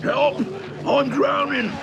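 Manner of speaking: loud, very high shriek for help; panicked cry. Acted. Soaked dubs calling for help, panicked shriek. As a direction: cry out as if drowning.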